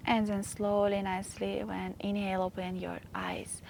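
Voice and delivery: soft, hushed yoga teacher voice; guided meditation. Acted. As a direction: ease them gently out of the meditation. Softly closes the meditation.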